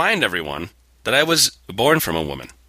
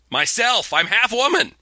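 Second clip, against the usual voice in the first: mock indignation; 9 semitones higher than usual. Absurd self-defense, mock indignant.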